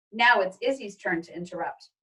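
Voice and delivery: plain reading voice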